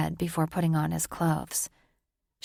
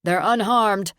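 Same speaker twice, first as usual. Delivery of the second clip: calling out; 3 semitones higher, 7 dB louder than usual. Acted. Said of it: "Tor called to the people below".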